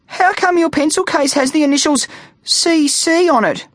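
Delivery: very high-pitched nervous question; quivering child. A narrator's reading. Grade three kid questioning the vampire's initials, quivering. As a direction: pipe up timidly, high and small. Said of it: "asked a quivering grade three kid".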